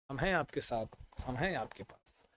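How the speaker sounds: soft, low and comforting; gentle reassurance. Real, not acted. Teacher telling worried exam students he is beside them, softly reassuring.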